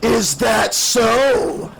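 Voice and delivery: menacing, mocking call, very slow; Freddy. A narrator's reading. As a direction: call it out slowly, stretching every word. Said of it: "Freddy's voice called from somewhere behind her".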